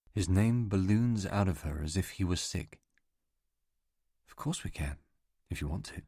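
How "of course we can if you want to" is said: much softer, even and composed; calm hushed reply; quiet and steady. Acted. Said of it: "Her voice is very quiet and steady".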